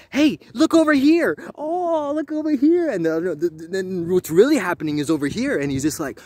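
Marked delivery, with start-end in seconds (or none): 0.1-2.9 high, loud showman distraction; mock magician patter. A magician misdirecting everyone, high showy patter. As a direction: lure the eye like a stage magician. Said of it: "being like".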